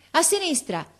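very high, falling cry; boy's shout. A narrator's reading. Boy leaning out of tree, shouting. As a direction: shout it down from the treetop. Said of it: "gridò il ragazzo".

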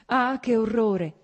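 slow, loud cry with the weight on "Ah"; "Ah" stretched and stressed, "che orrore" falling; anguished repeat opening on a long "Ah"; horrified exclamation. Acted.

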